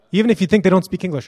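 ordinary delivery

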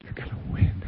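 unvoiced whisper of the promise. Coach whispering to his beaten players in the huddle. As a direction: breathe it to them like a secret. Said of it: "the coach looks at every single one of the players and says".

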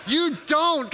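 high, insistent mock protest; exasperated retort. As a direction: insist it back at the nurse. Acting out patient contradicting nurse.

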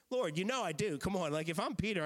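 fed up, pushing back at the third question; frustrated Peter. Retold or imitated. Pastor voicing Peter, fed up with being asked again. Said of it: "I'm getting a little frustrated".

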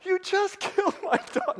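high, hammed-up accusation breaking into a laugh; mock outrage, laughing. Preacher jokes about the dog today, laughing mock outrage. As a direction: joke that you'd be glad now. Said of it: "now I'd be kinda like".

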